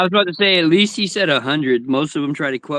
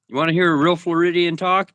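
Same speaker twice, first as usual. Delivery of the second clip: ordinary delivery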